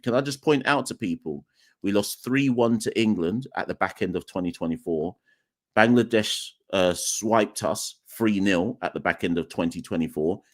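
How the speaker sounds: normal talking voice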